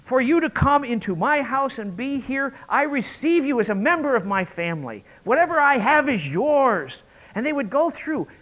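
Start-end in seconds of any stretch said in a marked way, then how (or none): 0.0-7.0 solemn host's blessing. Retold or imitated. Host's formal blessing speech.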